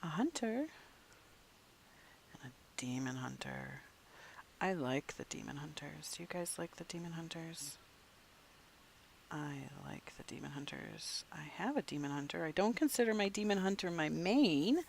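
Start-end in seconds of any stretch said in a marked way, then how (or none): 2.3-3.8 whispering. Real, not acted. Whispers many lines. 6.2-12.4 soft whisper to viewers. Real, not acted. Whispers many lines.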